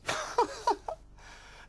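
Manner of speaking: high loud royal guffaw; king's booming laugh. Acted. King laughing at Tom turning up, roaring laugh. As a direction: laugh till you nearly fall off the throne. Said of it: "nearly fell off his throne laughing".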